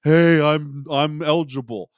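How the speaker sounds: mock showy eligible call; loud mock report. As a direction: belt it like a show. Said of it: "the whole song and dance of".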